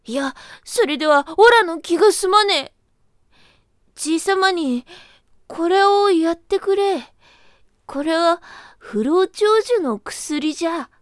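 a sly creature talking up its gift; earnest rabbit. Acted.

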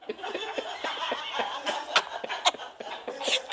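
soft, breathless, voiceless laughter; wheezing laugh. Real, not acted. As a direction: laugh until no voice comes out.

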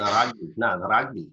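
South African "rugby" as "rahg-bee"; South African accent. Shown as a demonstration. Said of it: "how South Africans say rugby".

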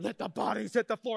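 mock metal-vocal chant; imitating a soundtrack. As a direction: chant it like the song's singer, hammed up. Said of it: "it's to the soundtrack".

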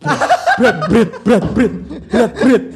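loud laughing repeated word; laughing strain. Real, not acted. Shopkeeper mimicking kids heaving the freezer lid, laughing.

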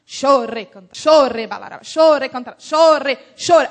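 imitated tongues with a punched, repeated beat; Ludmila praying. Pastora praying in tongues, imitated in punchy bursts. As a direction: mimic a pastor's rhythmic, forceful prayer. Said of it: "A pastora Ludmila orava".

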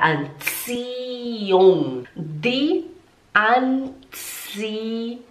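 Anziehung very slow, long "tsi" gliding into "ung"; silent H. Shown as a demonstration. Teacher shows the H is silent, slow drawn-out Anziehung. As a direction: stretch Anziehung with no H. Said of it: "ele não é pronunciado".

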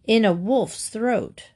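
ordinary delivery, nothing marked

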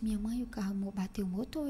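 hushed whisper to mom. Retold or imitated. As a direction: whisper it so no one wakes. Confessing to mom at night.

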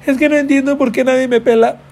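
high mock whiny lament; mock heartbroken whine. Mocking a heartbroken viewer, high whiny lament. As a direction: whine it like a dumped teen. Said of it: "o que andas".

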